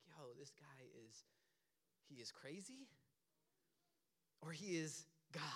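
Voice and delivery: low, very soft murmur of disbelief; hushed onlookers. Acted. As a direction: murmur it low to the person beside you. Onlookers react to Jesus's claim.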